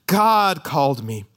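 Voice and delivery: "God" long and loud, then falling away; slow line led by a big "God"; stress on GOD. Shown as a demonstration. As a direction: hammer "God" as the one acting. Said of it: "the subjects changed in verse fifteen".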